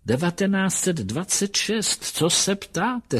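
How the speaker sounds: high-pitched woman's reply; old-fashioned lady character. A narrator's reading. Woman in the rocking chair answers a stranger's question, high lady's voice. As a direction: answer as the lady, voice lifted well up.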